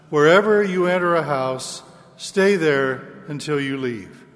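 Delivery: ordinary talk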